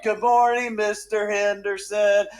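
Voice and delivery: put-on high, drawn-out singsong kids' chorus; children in unison. Kids greet Mister Henderson as if directed, imitated high chorus. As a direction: mimic little children chanting together as if conducted. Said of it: "it's like someone's directing them".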